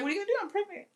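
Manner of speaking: high, soft what-can-I-do; mock innocent shrug. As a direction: play the smug pregnant card. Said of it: "it's like".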